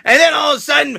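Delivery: usual speaking voice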